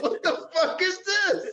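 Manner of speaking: high, drawn-out question breaking into laughter; laughing mock disbelief. Retelling wife's shock at the fantasy draft, laughing. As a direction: squeal the outrage while cracking up.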